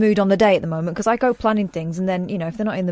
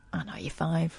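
whisper; 2 semitones lower, 11 dB quieter than usual. Retold or imitated. Quoted thought, whispered aside.

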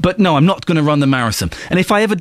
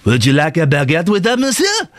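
put-on over-the-top French accent. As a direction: lay a fake French accent on thick. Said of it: "their French accents are so over the top".

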